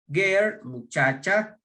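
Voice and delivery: ordinary talk, nothing marked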